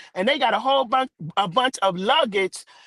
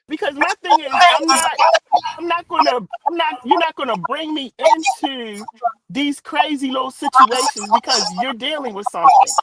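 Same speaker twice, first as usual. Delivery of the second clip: louder, insistent and firm; firm raised voice; 2 semitones higher, 6 dB louder than usual. Real, not acted. Speaker refusing to join others' drama, raised firm voice. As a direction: push back hard, refusing to be pulled in. Firm stand against being dragged in.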